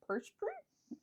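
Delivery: high, muffled through a mouthful of pastry; mouth full. Acted. Widow offering pastry with her mouth full. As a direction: talk through a big bite. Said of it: "then my mouth is full".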